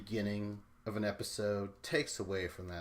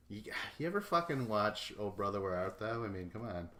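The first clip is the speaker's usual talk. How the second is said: usual speaking voice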